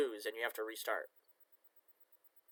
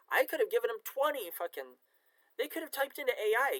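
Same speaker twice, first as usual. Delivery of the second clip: much higher, incredulous push; exasperated; 9 semitones higher, 3 dB louder than usual. Real, not acted. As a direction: squeeze it out in disbelief. Exasperated about the writers.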